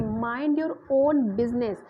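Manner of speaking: slow, "own" leaned on and falling; weight on the word own; stress on own. Shown as a demonstration. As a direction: hit "own" hard, the rest lighter. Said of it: "यानी own पे क्या जो focus किया जा रहा है".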